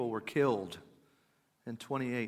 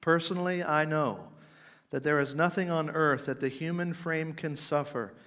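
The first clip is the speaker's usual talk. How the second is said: quoted preaching, higher and louder, easing at the end; Spurgeon; 3 semitones higher, 6 dB louder than usual. Retold or imitated. Spurgeon telling his congregation of despondency, emphatic declaiming. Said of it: "This is how he's speaking to his congregation".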